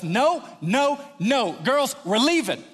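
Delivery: high, insistent parental command; urgent refusal. Retold or imitated. Dad pulling daughters away from a hug, urgent. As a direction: cut it off in comic panic. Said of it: "I couldn't get the words out fast enough".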